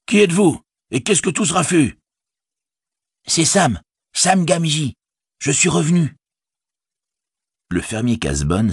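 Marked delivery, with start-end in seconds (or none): 0.0-2.0 high, demanding call; shouted challenge. A narrator's reading. Farmer questions a rider about the racket, shouting. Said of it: "cria-t-il". 3.3-6.1 high, eager hobbit voice; calling out identity. A narrator's reading. Sam answers the farmer's challenge, calling out.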